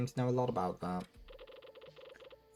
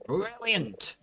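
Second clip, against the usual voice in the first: delighted exclaim; 13 semitones higher, 4 dB louder than usual. Acted. Delighted reply.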